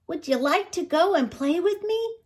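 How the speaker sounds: high-pitched little animal asking to play; high, hopeful little fox; eager young fox. A narrator's reading. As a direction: invite a new friend in a high young voice. Young fox invites badger to play.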